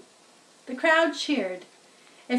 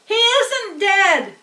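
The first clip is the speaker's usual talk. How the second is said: higher, louder, slow holler; 9 semitones higher, 8 dB louder, slower than usual. A narrator's reading. Farmer yells to the announcer, loud holler. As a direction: yell back at the loudspeaker. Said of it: "hollered Zuckerman".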